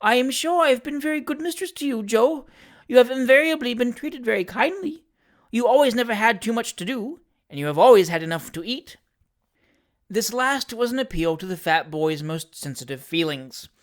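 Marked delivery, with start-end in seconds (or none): 0.0-9.0 put-on high old lady's voice, anxiously appeasing; trembling old lady. A narrator's reading. Old lady placating the servant she fears, nervously. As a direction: plead nervously as an old mistress. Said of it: "said the trembling old lady".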